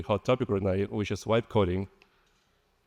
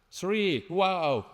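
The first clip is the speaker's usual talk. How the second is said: surprised; 9 semitones higher than usual. Real, not acted. Counting audience hands, surprised.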